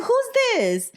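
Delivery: high, bright-eyed mock squeal; high mock auntie asking who this is; squeaky surprised question. Retold woman greeting, very high.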